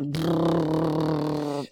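long buzzing phone-vibration trill; imitated phone buzz. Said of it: "and my phone's like".

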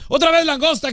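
high-pitched mock holler; imitated yelling. Teasing the friend about his lobster boast, mock yelling. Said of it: "tú gritabas".

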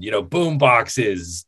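ordinary talk, nothing marked

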